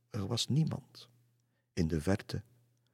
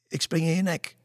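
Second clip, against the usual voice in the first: high, loud outburst; sudden cry; 10 semitones higher, 6 dB louder than usual. A narrator's reading. Kludde suddenly threatens Lisse, crying out high. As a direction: burst out with a threat. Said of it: "riep hij ineens".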